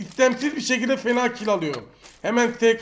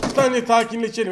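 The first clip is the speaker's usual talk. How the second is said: ordinary talk, nothing marked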